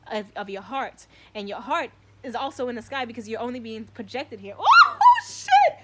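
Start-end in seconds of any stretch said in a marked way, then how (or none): 4.6-5.8 laughing, much higher yell of shock; excited shout. Real, not acted. Streamer hit by a sudden insight bursts out in a laughing shout. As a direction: blurt it out, half laughing, half yelling.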